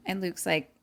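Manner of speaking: normal talking voice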